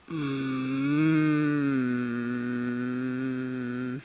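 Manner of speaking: usual speaking voice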